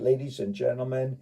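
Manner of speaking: ordinary delivery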